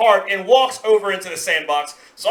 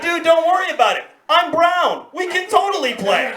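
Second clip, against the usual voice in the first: high, relieved reassurance; 8 semitones higher than usual. Retold or imitated. Boy assures his friend they can play, relieved. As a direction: breezily clear it up, pitched high. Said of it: "I became immediately relieved".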